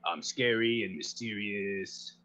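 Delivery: slow, drawn-out spooky villain; hammed-up menace, stretching the words out; scary, mysterious voice. Second try at the mysterious voice, slow hammed-up menace. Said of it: "mysterious as I imagined".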